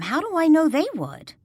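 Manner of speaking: a touch of rising alarm; anxious worry. Acted. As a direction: push it up as the doubt grows. Danielle voices worried doubt.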